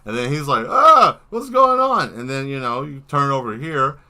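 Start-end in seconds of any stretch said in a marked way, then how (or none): 0.7-2.1 high, loud startled cry; mock alarm, loud and high; startled figure. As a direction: voice a painted figure taken aback, pitched way up. Voices the painted figure's reaction.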